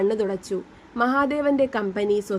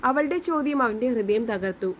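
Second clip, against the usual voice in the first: plain reading voice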